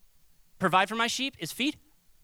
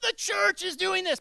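mock finger-wagging preacher, pitched much higher; judgy preacher; 10 semitones higher than usual. Shown as a demonstration. Pastor mocking a judgy preacher, high scolding tone. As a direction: sneer it like a browbeater on a soapbox. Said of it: "I'm not gonna be like".

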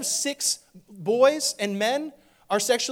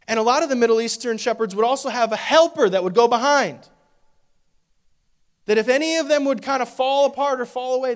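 preaching at full force; 4 semitones higher, 7 dB louder than usual. Real, not acted.

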